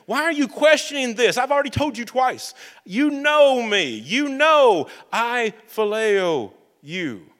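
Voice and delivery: a disciple wounded that he's asked again; upset pleading. Retold or imitated. Peter, hurt, pleads with Jesus that he already answered. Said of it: "And Peter grows upset".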